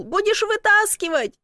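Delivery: high-pitched holler; shouted. A narrator's reading. Stuck man telling her to pull, shouted. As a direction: call out instructions from afar. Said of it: "крикнул".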